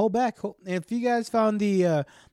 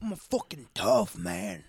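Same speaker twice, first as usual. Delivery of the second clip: low, slow macho growl; tough guy voice; 9 semitones lower, 4 dB quieter than usual. Pretend. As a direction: puff up like a hard man. Mock tough guy boast.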